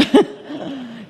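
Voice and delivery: a quick breathy laugh at herself; short real laugh. Real, not acted. Telling the group about the coffee, a short real laugh. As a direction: laugh it off, amused at her own stubbornness. Laughs at her own reluctance.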